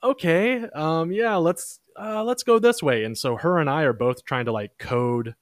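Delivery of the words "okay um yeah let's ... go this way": mock unsure voice. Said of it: "I'm like".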